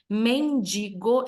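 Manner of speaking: "mendigo" slow and syllable by syllable, no n before the g; correct pronunciation. Shown as a demonstration. Teacher showing students the right form, slow careful "mendigo". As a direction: sound out "mendigo" slowly, ending in a clean -digo. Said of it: "essa é a pronúncia correta da palavra".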